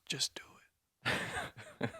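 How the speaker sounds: hushed ad voiceover whisper; soft whispered Nike tagline; whispered slogan. Pretend. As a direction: breathe it like a commercial tagline.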